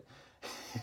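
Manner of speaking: breathy stifled giggle; a quick guilty laugh; suppressed giggle. Real, not acted. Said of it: "I shouldn't be laughing at that".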